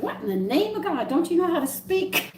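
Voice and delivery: aghast, pitching up on how to speak; mock outrage. Wife scolding husband for saying pecans wrong, mock indignant. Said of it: "I said".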